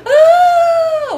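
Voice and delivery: long high-pitched "ah" squeal; drawn-out excited squeal. Real, not acted.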